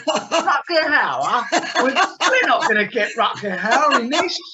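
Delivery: loud, high, laughing incredulity; mock disbelief. Filmmaker recalling seeing Rutger's name in the paperwork, incredulous. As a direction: scoff loudly through a laugh, drawn out. Retells his disbelief at the casting.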